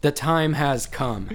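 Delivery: slow, loud, high proclamation; dramatic announcer. Pretend. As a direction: announce it like a grand herald.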